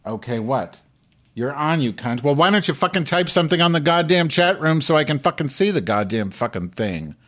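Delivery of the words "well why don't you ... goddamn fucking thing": furious, loud and high rant; angry yelling. Real, not acted. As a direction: yell at the screen in rage. Yelling at chat room.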